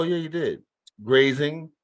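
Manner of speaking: usual speaking voice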